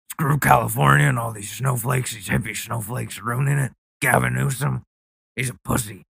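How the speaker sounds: cartoon macho Texan wannabe; mock macho bro. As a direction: play a swaggering guy bragging about moving to Texas. Impersonating a Texas-bound macho guy.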